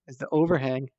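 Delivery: ordinary talk, nothing marked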